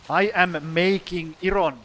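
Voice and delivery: attempted Finnish accent. Shown as a demonstration. Streamer testing his lost accent, attempted Finnish accent. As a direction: try a Finnish accent, not quite landing it. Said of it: "I can't even do the Finnish accent anymore".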